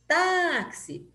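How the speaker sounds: TÁ in "Táxi" long and high; stress on TÁ. Shown as a demonstration. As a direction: call out "Táxi", stretching the first syllable. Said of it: "Quando é a penúltima que é forte".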